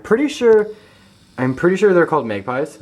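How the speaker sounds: sudden high, loud burst about the bird; excited. Real, not acted. Vlogger points out the magpie to viewers, excited. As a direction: pitch jumps up with excitement.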